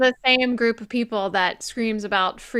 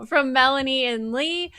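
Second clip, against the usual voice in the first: usual speaking voice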